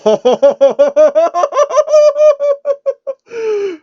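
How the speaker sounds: high, helpless laughing fit; cracking up at the absurdity; real loud laugh; loud burst of laughter. Real, not acted. Reader cracks up.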